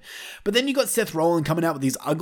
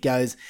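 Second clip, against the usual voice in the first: mock Rollins laugh; 3 semitones lower than usual. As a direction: imitate Seth Rollins's stupid laugh, slowly. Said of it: "stop doing the fucking stupid laugh".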